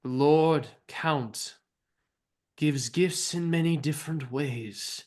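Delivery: slow, deliberate proclamation; Fiona speaking out. Acted. Fiona addresses red-cloaked followers at the lake, slow proclamation. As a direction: address the followers in spaced, weighty phrases. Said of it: "she speaks out".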